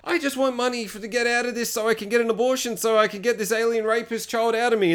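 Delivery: high put-on girl's voice; mock-desperate, high; pleading girl. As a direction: imitate her pleading, pitch held up throughout. Retold girl pleads her case.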